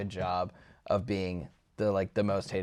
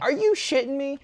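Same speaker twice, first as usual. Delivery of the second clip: high squeaky incredulous voice; a hammed-up Victor scoffing; mock disbelief; 4 dB louder than usual. Mocking Victor's reaction.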